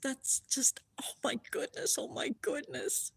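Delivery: soft, high, whispery wonder; real choked-up amazement; could cry with awe. Real, not acted. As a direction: fade to a hushed, overwhelmed whisper. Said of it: "I could cry".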